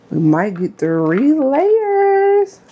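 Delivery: stretched out, climbing to a high held note; playful sing-song. Pretend. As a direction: draw every word out, rising very high. Playful repeat of her own line.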